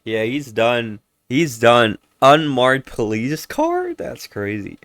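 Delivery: ordinary talk, nothing marked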